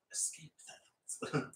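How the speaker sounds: slow breathy whisper. Real, not acted. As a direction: breathe it out dreamily. Dreamy aside about escaping.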